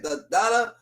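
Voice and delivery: ordinary delivery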